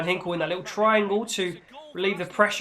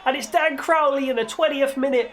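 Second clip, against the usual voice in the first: goal call; 5 semitones higher than usual. Acted. Commentator calling his team's opening goal, excited.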